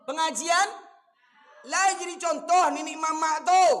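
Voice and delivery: loud, high, hammering sermon cries; fiery preaching. Acted. Preacher scolding elders at weddings, loud fiery preaching. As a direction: thunder it at the congregation.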